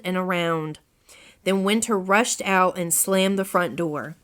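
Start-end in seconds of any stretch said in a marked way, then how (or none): none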